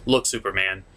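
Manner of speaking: normal talking voice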